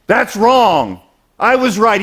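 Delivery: loud, high indignant outburst; upset protest. Acted. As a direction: act out someone upset, insisting loudly. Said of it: "he doesn't get upset".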